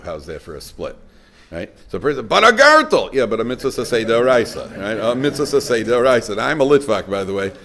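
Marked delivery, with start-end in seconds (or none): 2.3-3.1 loud, high mock objection; mimicked protest. Preacher mimics someone fixated on the gartel, protesting. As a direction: mimic an objector, pitch shooting up.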